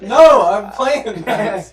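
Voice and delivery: high, slow, loud protest; drawn-out mock-indignant shout; retold loud refusal. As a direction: act out refusing to leave a game. Acted loud refusal to be interrupted.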